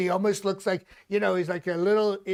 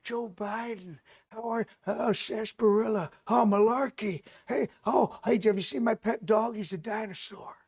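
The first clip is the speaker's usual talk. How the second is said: Joe Biden; 3 semitones higher than usual. Acted. Comic mocking a wandering old president, doddering impression. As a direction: mumble along like a confused old politician.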